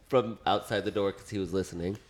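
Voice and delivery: ordinary talk, nothing marked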